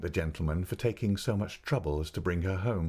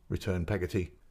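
ordinary delivery, nothing marked

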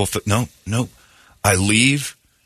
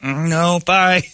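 hasty brush-off; 9 semitones higher than usual. Retold or imitated. Hanging up quickly.

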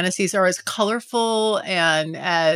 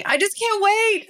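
high, giddy squeal of excitement; 12 semitones higher than usual. Real, not acted. Host gushing to listeners about her hookup, giddy squeal. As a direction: squeal it like you can barely hold it in.

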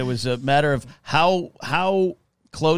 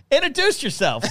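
playful shout; 11 semitones higher than usual. Retold or imitated. Nudging friend to give name.

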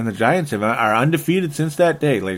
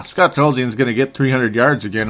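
ordinary delivery

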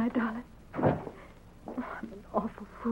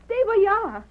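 raised, tense voice, fear held down; frightened mother; 11 semitones higher, 9 dB louder than usual. Acted. Mother calling to her daughter upstairs, frightened. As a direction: call out bravely though terrified.

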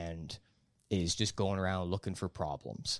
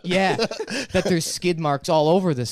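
loud punchline; 6 semitones higher, 9 dB louder than usual. Real, not acted. Punchline rant laughing.

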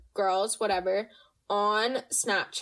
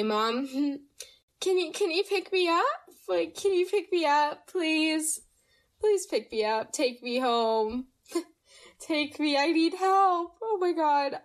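higher-pitched acted plea for rescue; mock pleading; 5 semitones higher than usual. Kid begging mom to pick them up, mock pleading.

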